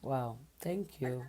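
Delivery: barely able to speak, genuinely touched; overwhelmed and quiet. Real, not acted. Moved by a donation offer.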